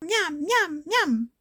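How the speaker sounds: high little creature voices; munching creatures. Acted. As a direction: chant it high, like hungry little monsters. Voicing the munching miam creatures. (petites créatures voraces, voix aiguë)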